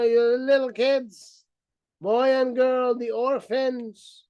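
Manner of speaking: normal talking voice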